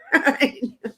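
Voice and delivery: quick high laugh; a burst of real laughter; short laugh. Real, not acted. Medium laughing at the spirit's joke, short high laugh.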